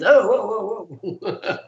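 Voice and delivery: high, mock-startled whoa. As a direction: back off in mock alarm.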